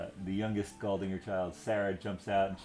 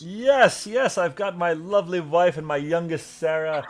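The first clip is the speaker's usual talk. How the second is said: put-on loud, high, hearty lord greeting old friends; jovial noble lord; 9 semitones higher, 7 dB louder than usual. Sir Galdinger greets the party, loud and jovial. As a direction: play a booming, delighted nobleman.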